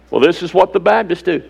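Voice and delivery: a hint of a smug churchgoer, in a different voice; mock churchgoer voice. Preacher mocking Baptist habit, lightly put-on voice. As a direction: mimic the excuse lightly.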